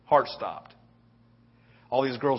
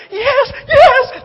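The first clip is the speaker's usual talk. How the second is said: high, loud whooping cheer; dorky cheering dad; 13 dB louder than usual. Retold or imitated. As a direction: shout it high like a proud dad celebrating. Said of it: "I literally started doing this".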